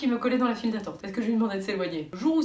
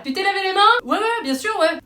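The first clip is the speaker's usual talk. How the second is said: high mock dialogue; 9 semitones higher, 9 dB louder than usual. Quoting a nagging question and a lie.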